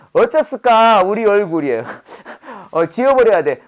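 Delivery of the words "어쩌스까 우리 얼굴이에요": light Jeolla dialect lilt on 어쩌스까; playful Jeolla country accent. Pretend. Teacher jokes to class in Jeolla dialect. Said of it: "전라도 말로 말하는 거예요".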